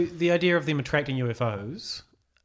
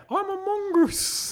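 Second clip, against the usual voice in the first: very high squeaky creature voice; squeaky mongoose voice; 3 dB louder than usual. Retold or imitated. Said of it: "Stuff like what Cam just said".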